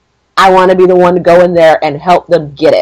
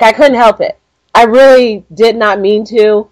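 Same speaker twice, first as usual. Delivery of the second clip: ordinary talk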